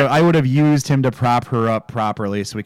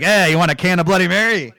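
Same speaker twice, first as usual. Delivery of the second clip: lightly voiced pitched-up chummy offer; Sam Axe; 7 semitones higher, 3 dB louder than usual. Podcaster quotes Sam offering a drink, lightly acted chummy voice. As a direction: call out a casual drink offer, a little higher. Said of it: "we get to Sam Axe once again".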